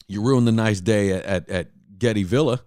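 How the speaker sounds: usual speaking voice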